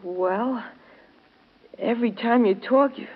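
normal talking voice

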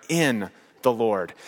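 slow, drawn-out "in", then "the Lord"; emphatic, lengthened "in"; stress on IN. Real, not acted. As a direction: hold the word "in" before finishing "the Lord". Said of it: "I can't emphasize that word enough".

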